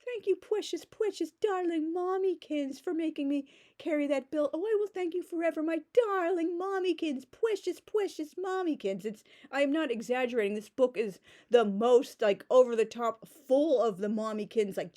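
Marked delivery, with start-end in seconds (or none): 0.0-9.0 high, syrupy mock adoration; mocking gushing daughter. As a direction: coo "precious, precious Mommykins" like a fawning child. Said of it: "lovingly genuflect before the altar".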